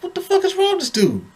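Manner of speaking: high-pitched disbelief; incredulous. Retold or imitated. Viewer reacting to the passive husband on the news, incredulous. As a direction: ask it way up high, baffled.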